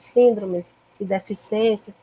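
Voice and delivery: ordinary talk, nothing marked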